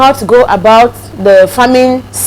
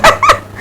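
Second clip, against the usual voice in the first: short giggle. Real, not acted. Reacting to teasing hint.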